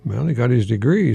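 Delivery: usual speaking voice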